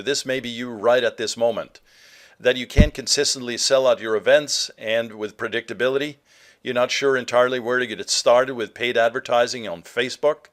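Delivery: ordinary talk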